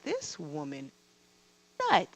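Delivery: low, hushed imitation of judging onlookers; disapproving onlookers. Bosses staring at the crying employee, muttered mock disapproval.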